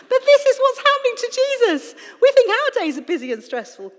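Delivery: loud, high, emphatic shout; shouting at listeners. Real, not acted. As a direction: shout the point home at the audience. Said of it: "me shouting at you".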